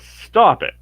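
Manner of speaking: high, loud command to stop; shout. Acted. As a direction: yell it to break up the fight. Helga yells at the fighters.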